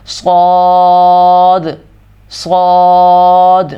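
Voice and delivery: صاد slightly thick, not like س; صاد medium-heavy and long; heavy saad. Shown as a demonstration. Teacher modelling صاد, medium heavy. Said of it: "درمیانے انداز کے اندر اس کو ادا کرنا ہے".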